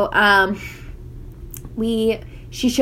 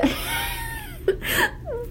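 burst of laughter; 7 dB quieter than usual. Real, not acted. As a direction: shriek with laughter. Friend acts like bird.

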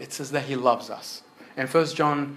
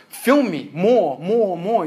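greedy demanding; 5 semitones higher, 7 dB louder, slower than usual. Retold or imitated. Mock greedy demand to God.

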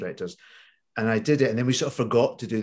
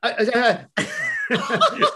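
speechless stammer; 4 dB louder, much slower than usual. Retold or imitated. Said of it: "I was just, like".

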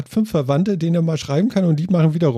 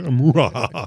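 villain laugh; 4 semitones lower, slower than usual. Pretend. Repeating the evil laugh.

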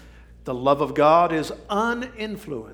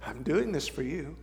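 tender hush; 11 dB quieter than usual. Acted. Preacher voicing Jesus speaking softly to mockers. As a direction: breathe it gently. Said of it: "he said".

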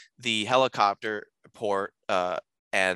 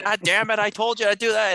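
louder and higher, mock annoyed snapping; hammed-up spouse squabble, higher and louder; mock bickering; 11 semitones higher, 5 dB louder than usual. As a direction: play a bickering old spouse, loud. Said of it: "start bickering like an old couple".

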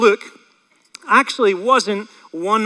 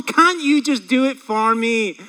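high whiny complaining voice; whiny man child; 6 semitones higher, 6 dB louder than usual. Pretend. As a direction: whine like a sulky kid. Preacher acting whiny reluctance.

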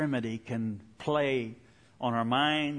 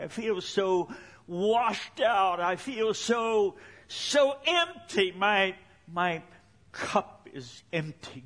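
begging God; 5 semitones higher than usual. Retold or imitated. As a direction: plead with growing desperation, then drop back. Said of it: "begged God".

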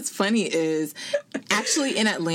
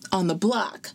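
usual speaking voice

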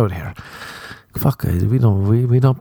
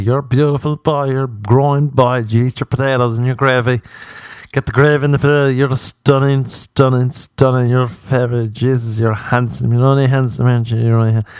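higher, gushing doting-aunt voice; stupid aunt voice; 3 semitones higher than usual. Retold or imitated. Aunts gushing over a nephew's Facebook photo, high and gushing. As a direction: gush over him like a doting Irish aunt. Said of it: "doing that stupid voice".

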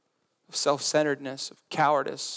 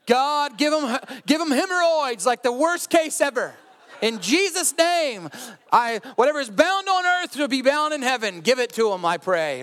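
high-pitched, fervent mock prayer; mock vengeful prayer; 8 semitones higher, 4 dB louder than usual. Praying aloud against someone who wronged you, mock fervour. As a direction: call down curses in a hammed-up prayer.

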